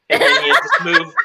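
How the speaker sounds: words breaking into a laugh, pitch jumping high. Real, not acted. Co-host joking about leaving LA, laughing as he speaks. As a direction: laugh through the line. Laughing at his own story.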